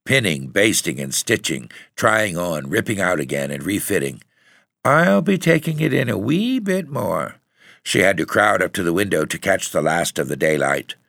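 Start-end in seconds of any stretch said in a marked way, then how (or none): 4.8-7.4 put-on little girl. A narrator's reading. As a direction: pitch it up like a busy little girl. Girl intent on her sewing.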